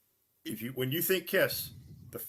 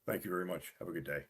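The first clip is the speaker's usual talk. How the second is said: low, softer polite dismissal; polite brush-off; 7 semitones lower, 7 dB quieter, much faster than usual. Retold or imitated. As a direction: close the deal with canned politeness. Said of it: "Enjoy your movie".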